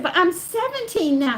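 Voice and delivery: lively re-enacted objection; insistent protest. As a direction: insist on being old enough. Acted argument over her age.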